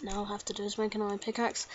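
ordinary delivery

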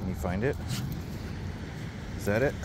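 ordinary talk

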